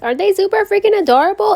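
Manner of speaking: high, loud, quick gush. Real, not acted. Showing viewers tiny paw nails, high excited gushing. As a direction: squeal over how cute they are.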